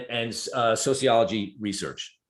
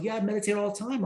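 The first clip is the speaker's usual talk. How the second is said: put-on breezy young man; 10 semitones higher, faster than usual.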